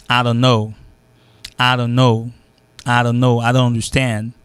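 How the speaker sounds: "I don't know", "I don't understand" starting on "ah"; I becomes A. Shown as a demonstration. Teacher drilling conversational accent, "I" reduced to ah. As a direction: say "I" as ah, run into "don't". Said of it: "il vient A".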